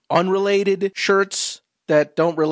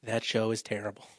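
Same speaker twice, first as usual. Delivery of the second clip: lower, softening as it goes; disapproving sister; 4 semitones lower than usual. Acted. Sister telling her brother her verdict, disapproving sister. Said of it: "She'll say".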